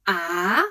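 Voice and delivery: questioning upward "ah"; rising second tone. Shown as a demonstration. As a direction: slide "ah" smoothly upward. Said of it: "The second tone is about racing".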